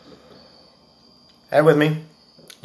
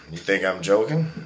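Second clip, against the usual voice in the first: usual speaking voice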